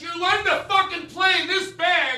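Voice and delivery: high, hammed-up shout at the band; shouting singer. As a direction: scream abuse at the old drummer. Singer yelling at old band.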